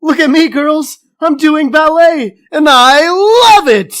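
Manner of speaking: giddy ballerina, way up high; high gushing girly voice. Acted. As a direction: squeal it out, high and loud.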